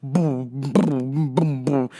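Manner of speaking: low mouthed boom boom; imitated bass thump. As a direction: beat it out like a bass line. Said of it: "I could tell what song it was by the boom".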